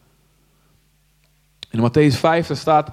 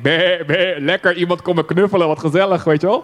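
lamb's thoughts. Acted. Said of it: "En het lammetje denkt".

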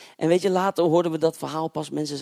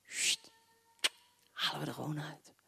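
whispered snipping noise; snip sound; 10 dB quieter than usual. Retold or imitated. Preacher mimicking a believer cutting out miracles, whispered snip. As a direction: cut it off with a hiss. Removing the cross.

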